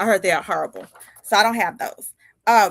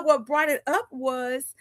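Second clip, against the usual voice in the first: ordinary delivery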